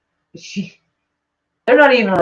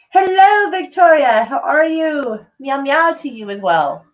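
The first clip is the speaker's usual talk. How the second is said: high, slow, drawn-out hello; sing-song greeting; 7 semitones higher, slower than usual. Real, not acted. Streamer welcoming a viewer, high sing-song greeting. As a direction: greet sweetly in a much higher, stretched voice.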